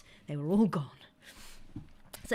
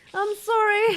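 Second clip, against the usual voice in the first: louder, higher sorry; loud apology; 9 semitones higher, 7 dB louder, slower than usual. Real, not acted. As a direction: call out the apology. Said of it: "repeated apology, louder".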